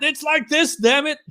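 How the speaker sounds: high mock outburst; a hint of an exasperated friend; mock fist-shaking friend. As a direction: shake a fist and act fed up. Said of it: "[name]'s throwing his fist in the air".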